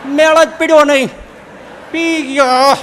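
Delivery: gleeful telling. Acted. Third friend tells the others he drank it: loud gloating. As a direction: crow over the two dreamers.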